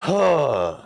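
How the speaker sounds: long exasperated sigh; weary sigh. Real, not acted. The host sighs at Trump's boast, weary. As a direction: breathe out, fed up.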